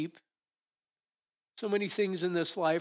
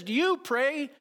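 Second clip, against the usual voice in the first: emphatic quote; 6 semitones higher, 6 dB louder than usual. Retold or imitated. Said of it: "He says".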